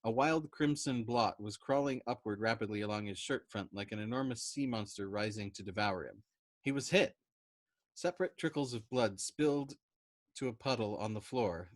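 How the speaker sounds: ordinary delivery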